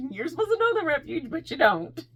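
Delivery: high, teasing self-reproach; mock self-scolding. Telling herself she should know them, mock scolding. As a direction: pitch up as if chiding yourself for being silly. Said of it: "I felt so dumb".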